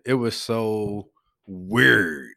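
Louisville accent, slow and low with a long drawn WEIRD; very slow Louisville drawl on weird. Shown as a demonstration. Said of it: "say it in my Louisville accent".

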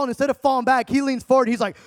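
ordinary talk, nothing marked

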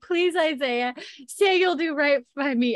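high-pitched pleading wail; mock tearful. Young woman confessing to Isaiah, mock tearful pleading. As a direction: plead in a hammed-up weepy voice. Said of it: "And she's crying".

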